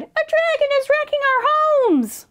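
high, hurried wail; distressed cry. A narrator's reading. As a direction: cry out in storybook panic. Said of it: "the animals cried".

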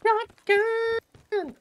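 very high squeaky voice; squeaky shotgun. Pretend. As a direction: squeak it out in disbelief. Mock reaction to price.

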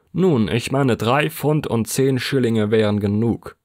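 lightly voiced official offering a sum; a hint of a prim board member; Limpkins naming a price. A narrator's reading. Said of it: "sagte Herr Limpkins".

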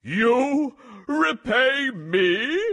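slow, high, incredulous lion; laughing disbelief. A narrator's reading. Lion mocking the mouse's offer, drawn-out amused disbelief. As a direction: stretch it out, rising in amused disbelief. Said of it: "the lion laughed".

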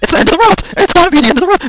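real panic over a five-minute timer; frantic panic. Real, not acted. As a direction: yell in disbelief and panic.

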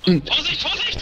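high, urgent warning cries; panicking gamer. Real, not acted. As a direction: yell a sudden warning. Warning teammate in game.

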